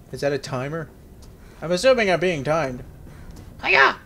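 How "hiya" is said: high, loud jump cry; a sudden karate-style yelp; effort yell. Pretend. Jumping in game.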